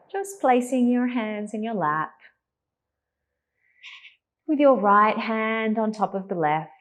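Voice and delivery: calm, unhurried meditation voice with long pauses; guided meditation. Real, not acted. Teacher settling a class into meditation, calm and spacious. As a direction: ease them gently into stillness, leaving space to breathe.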